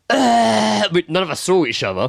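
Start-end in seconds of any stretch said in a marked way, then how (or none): 0.1-0.9 loud, high straining groan; imitated groan. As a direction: mimic the man groaning instead of answering. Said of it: "The guy was just like".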